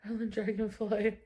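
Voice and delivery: ordinary talk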